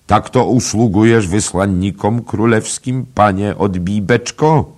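loud, high-pitched indignation; exclaimed rebuke. A narrator's reading. Royal envoy scolding the innkeeper, exclaimed rebuke. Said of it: "zakrzyknął drugi z drużyny".

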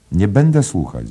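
high, loud refusal; crying out. A narrator's reading. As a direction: call out in protest, refusing. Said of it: "zawołała".

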